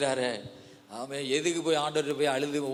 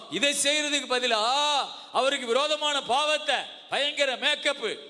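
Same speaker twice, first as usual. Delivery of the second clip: high, impassioned sermon voice; full-force preaching; 10 semitones higher than usual. Real, not acted. (உரத்த ஆவேசப் பிரசங்கம்)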